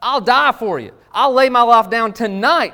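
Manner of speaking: high, loud fervent promise; bold vow. Acted. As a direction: swear loyalty at full voice. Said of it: "Peter's like".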